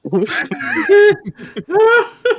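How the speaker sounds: high, helpless laugh-sobs; real tears of laughter, can't stop; crying with laughter. Real, not acted. Host cracking up at the players' roast, laughing till crying. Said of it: "I'm crying".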